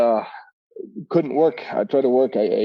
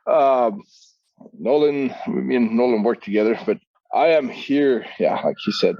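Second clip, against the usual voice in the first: ordinary talk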